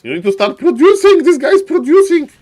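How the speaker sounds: loud urging shout; mock coaching yell. Caster yelling at player.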